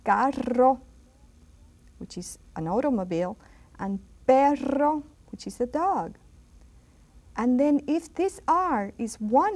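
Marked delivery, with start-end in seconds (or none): 0.0-0.8 carro with a long trilled rr; carro, rr trilled several beats; rolled double R. Shown as a demonstration. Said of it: "when it's double R". 3.8-5.0 perro with a long trilled rr; perro, rr trilled several beats; rolled double R. Shown as a demonstration. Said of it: "when it's double R".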